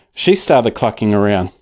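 plain reading voice

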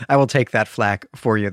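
ordinary talk, nothing marked

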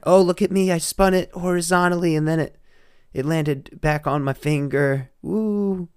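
loud, high mocking braggart voice; mocking show-off voice. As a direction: ham up a smug show-off kid. Mocking spinner kids, loud high voice.

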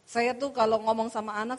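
ordinary delivery

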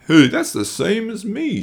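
high squeaky bear voice; Ralph. A narrator's reading. Ralph chiming in at the council, high and eager. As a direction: jump up high, eager to join in. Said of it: "said Ralph".

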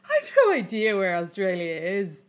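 giggling out the line, half laughing; laughing while quoting. Retold or imitated. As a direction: quote it through a burst of laughter. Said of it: "laughs and goes".

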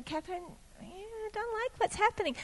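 very soft, gentle nudge; hushed, gentle admonishing. Retold or imitated. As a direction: imitate a quiet inner voice, gently insistent. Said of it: "he just gently kept speaking to me".

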